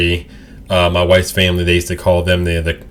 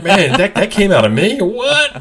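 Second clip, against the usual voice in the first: amazed at myself; 12 semitones higher than usual. Retold or imitated. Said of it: "I sit back and I'm like".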